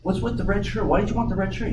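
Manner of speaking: a touch of a curious sailor; curious first mate. Acted. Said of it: "first mate comes to the captain and says".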